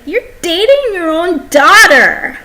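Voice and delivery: loud, high shout of disgust; disgusted outburst. Retold or imitated. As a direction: yell it at him in disgust. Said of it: "I was like".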